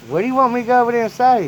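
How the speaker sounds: put-on high, fast flustered child voice; confused little kid. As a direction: blurt it out like a nervous kid. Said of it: "And I was like".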